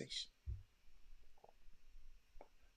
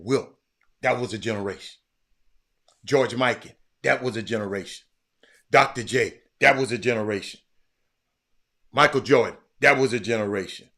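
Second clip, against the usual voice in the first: rising to a loud, louder and louder roll call; building emphatic quote; 11 dB louder than usual. Retold or imitated. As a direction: hammer each name harder and harder.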